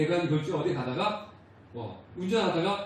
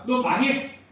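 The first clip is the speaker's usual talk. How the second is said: higher, louder curse; 6 semitones higher, 4 dB louder, slower than usual. Retold or imitated. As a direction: fling it out as a condemnation. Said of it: "이렇게 저주하시는 분은 아니시라는".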